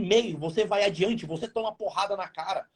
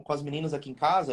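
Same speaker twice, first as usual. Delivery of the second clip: normal talking voice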